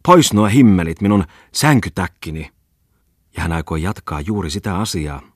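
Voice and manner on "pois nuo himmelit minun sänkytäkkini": higher, much louder cry; a startled lady shouting at the boat; exclaiming. A narrator's reading. Lady cries out as the motorboat hits the pier, loud. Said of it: "rouva huudahti".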